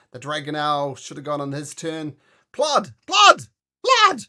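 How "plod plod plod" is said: high, very loud repeated shouts; piercingly high character yell; calling out. Acted. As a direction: holler the name, each time higher. Acted calling out in the battle.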